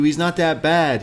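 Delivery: coaxing friends, voice lifted high; high mock reassurance. Group's quote vs Johnny's.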